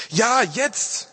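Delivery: high, urgent reply; impatient insistence. A narrator's reading. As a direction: insist on it right now. Impatient men answer the computer.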